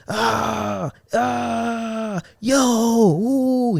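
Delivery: high, hammed-up hollering of nonsense; playful mock holler; mock yelling. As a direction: imitate a coworker yelling at nothing. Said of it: "He be yelling and stuff".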